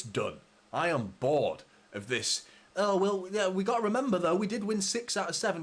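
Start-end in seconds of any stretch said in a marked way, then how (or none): 2.8-5.6 mocking other fans. Mocks complacent fellow City fans.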